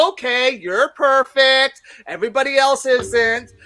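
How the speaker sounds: slow, loud mock concession; playful teasing. Comic playing with a drunk lady, teasing agreement. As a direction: humour her with exaggerated, drawn-out agreement. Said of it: "I was playing with her".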